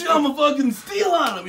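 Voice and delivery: high, loud, hammed-up yelling; mock threat. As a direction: imitate a hothead bragging he'll attack. Acting out drunk man's threat.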